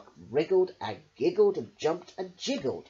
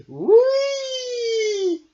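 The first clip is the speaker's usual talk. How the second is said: high, loud thrilled squeal; gleeful whee; 12 dB louder than usual. A narrator's reading. Shark and fish racing off, loud gleeful squeal. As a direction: squeal while zooming away. Swimming fast together.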